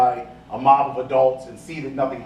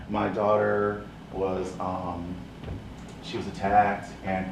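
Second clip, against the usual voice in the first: slow, softer, lower voice; timid mother; 6 semitones lower, 7 dB quieter, slower than usual. Retold or imitated. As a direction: speak meekly, afraid to tell it.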